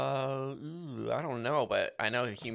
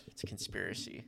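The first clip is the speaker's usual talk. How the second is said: whispering; 4 semitones lower, 12 dB quieter than usual. Pretend. Hushed conspiracy joke.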